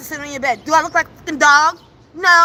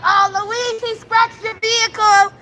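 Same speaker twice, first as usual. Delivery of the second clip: excited tattling; 6 semitones higher, slower than usual. Retold or imitated.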